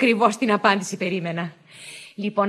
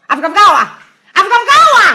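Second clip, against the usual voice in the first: shouted call, rising higher on the repeat; loud summons; 8 semitones higher, 12 dB louder than usual. Acted. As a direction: yell a name to someone in another room. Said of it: "τότε θα τη φωνάξω εγώ". (δυνατό φώναγμα, κάλεσμα)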